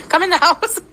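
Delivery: put-on high, laughing kid voice; playful child imitation; imitating child. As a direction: mimic the little girl.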